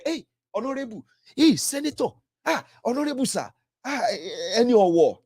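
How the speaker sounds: high, simpering mock flattery; mock fawning greeting. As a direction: ham up the bootlicking crony. Mocking flatterers greeting politicians.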